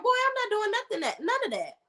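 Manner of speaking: high-pitched, sassy brush-off of a pushy suitor; a woman telling the suitor off, high and sassy; sassy comeback. Retold or imitated. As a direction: pitch way up and tell him off with attitude. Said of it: "Girl, I would have been like".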